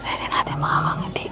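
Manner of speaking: unvoiced whisper; whisper, careful not to wake him; quiet at night. Real, not acted. Afraid boss wakes.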